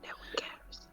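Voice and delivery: breathy unvoiced whisper. Real, not acted. Friend whispering a dismissive aside. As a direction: hiss it under your breath.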